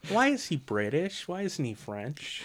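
high, drawn-out questioning; hammed-up bewilderment, slow rising questions; mock incredulity. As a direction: act out baffled protest, pitch leaping up. Acts out own puzzled objection.

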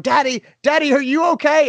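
put-on high, upset child calling out; hammed-up worried child voice; worried daughter. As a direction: imitate a frightened little girl. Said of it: "my daughter is devastated. She's going".

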